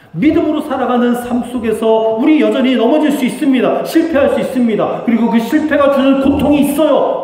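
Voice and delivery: loud, high-pitched sermon delivery; impassioned, ringing pulpit proclamation; full-force preaching. Real, not acted.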